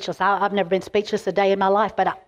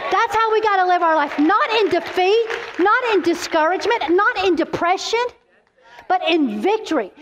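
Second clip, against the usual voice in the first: higher, rising preaching cadence; preaching at full force; 8 semitones higher than usual. Real, not acted. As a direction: build it up like a pulpit climax. Preaching climax.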